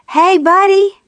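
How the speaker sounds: high, bright calling raccoon voice; cheerful raccoon call. A narrator's reading. Raccoon calling to Buddy from a snowdrift, cheerful high voice. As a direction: sing it out like a happy little raccoon. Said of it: "called out cheerfully".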